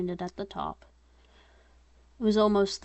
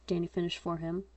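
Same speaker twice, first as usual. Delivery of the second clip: usual speaking voice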